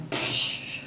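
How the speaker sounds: long hissed "pshhh" sound; imitated whoosh. Shown as a demonstration. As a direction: blow out a quick whooshing noise. Imitated noise finishing her sentence.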